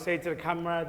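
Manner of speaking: usual speaking voice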